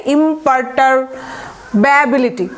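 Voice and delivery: ইমপারটারব্যাবিলিটি slowly joined up, chunk after chunk; teacher's slow, piece-by-piece ইমপারটারব্যাবিলিটি; broken into parts. Shown as a demonstration. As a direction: sound out ইমপারটারব্যাবিলিটি slowly, pausing before ব্যাবিলিটি. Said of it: "ভেঙে ভেঙে উচ্চারণ করলে আপনাদের ভুল হবে না".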